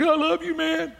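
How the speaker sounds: mock tearful. Preacher to the bank president after praying, mock tearful. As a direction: squeak it out as if weeping. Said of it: "now I'm crying. My sprinkler system's on".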